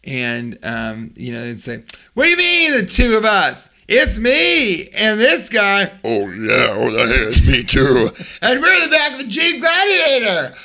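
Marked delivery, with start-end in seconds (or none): 2.1-5.9 much higher, slow mock father voice; high mock dad voice. Absurd father answering the teacher, high and hammed-up. 6.0-8.1 a different, slower put-on dad; second father chimes in, lightly voiced. Other father agreeing in a slow put-on voice. 8.4-10.5 much higher, louder hammed-up dad; high shouting dad. Father shouting his claim at teacher, high and hammed-up.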